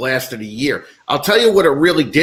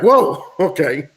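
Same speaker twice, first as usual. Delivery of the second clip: slowed, higher mock surprise with a laugh; drawn-out startled whoa, laughing it off; taken-aback whoa; 3 semitones higher, 4 dB louder, slower than usual. Retelling the casino woman's demand, mock-startled and laughing. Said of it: "And I was just like".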